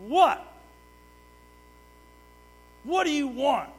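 loud, high, snippy retort; bratty back-talk. Retold or imitated. Daughter talking back to her father, loud and snippy. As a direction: sass back like a bratty kid. Imagined bratty child reply.

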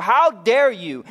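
a hint of a furious deity; mock angry God. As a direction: thunder it like a wrathful god, hammed up. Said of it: "a fist or a hammer waiting to come down".

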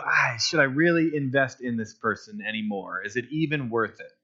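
low, soft, trailing-off doubt; doubtful musing. Acted. Churchgoer weighing whether to invest in someone, doubtful musing.

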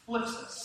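thlipsis as TH-L then LIP-sis, calling the room to repeat; say thlipsis together. Shown as a demonstration. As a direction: lead the room in saying thlipsis.

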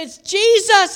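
high, loud amazed exclaim; excited cry. Retold or imitated. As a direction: call out in astonishment. Disciples spotting Jesus on water.

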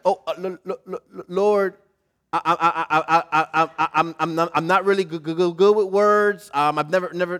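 halting, tripping on every word; stammering Moses. Shown as a demonstration. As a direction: play Moses tongue-tied before the Lord. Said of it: "It probably would have really sounded like this".

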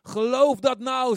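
high, loud, slow cry; God's insistent plea. Acted. God urging the listener to believe, loud insistent cry. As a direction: shout it as an urgent command. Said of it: "zegt God".

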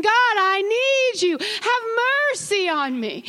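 crying out to God. Pretend. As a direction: break into a tearful cry for mercy. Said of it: "nothing will stop you from saying".

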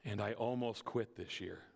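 put-on an old woman admitting her doubt; understated sorrow of Patty; quiet confession. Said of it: "She said".

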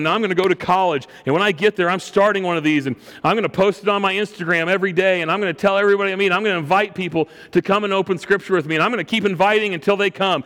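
high, rushed, building vow without sobs; retold impassioned bawling. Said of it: "And she's bawling".

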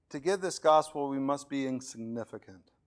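ordinary delivery

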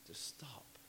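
hushed command to stop; a sudden hushed stop; whispered. Real, not acted. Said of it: "unvoiced whisper".

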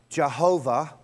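Jehovah said slowly, stress on the middle HO; Jehovah, measured teaching pronunciation. Shown as a demonstration. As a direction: sound out Jehovah as juh-HO-vuh. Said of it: "can be pronounced two ways".

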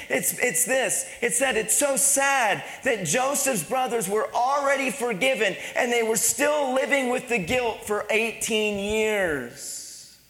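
impassioned preaching. Real, not acted. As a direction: press the story home to the congregation. Preaches on guilt at full force.

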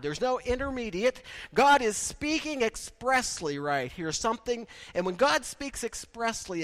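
ordinary talk, nothing marked

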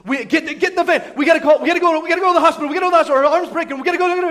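high, loud, frantic repetition; absolute panic. Retold or imitated. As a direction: act out losing your head in an emergency. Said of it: "absolute panic".

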